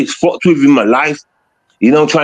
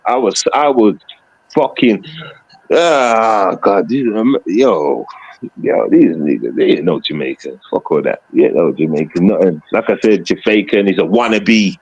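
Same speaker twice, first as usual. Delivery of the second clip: much lower put-on voice, dismissive; lower, scornful put-on voice; mock deep voice; 9 semitones lower than usual. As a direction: drop way down into a mocking caricature voice.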